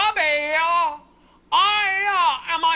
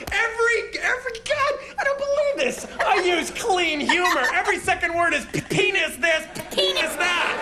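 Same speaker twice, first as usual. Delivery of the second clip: incredulous laughing rant, rising high; laughing outrage; 10 semitones higher than usual. Real, not acted. Host laughing at the doctor's claim of clean humor. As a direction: sputter through laughter at the hypocrisy. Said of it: "Look how angry he gets".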